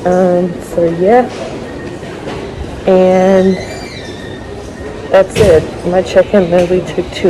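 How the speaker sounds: muffled behind a face mask; talking through a mask. Real, not acted. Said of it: "I don't know if you can hear me with this mask".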